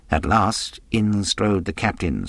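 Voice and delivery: usual speaking voice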